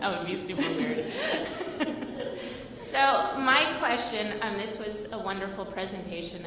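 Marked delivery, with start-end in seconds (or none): 0.0-1.1 laughing agreement. Real, not acted. 1.1-2.7 high airy laugh. Real, not acted. Shared laughter.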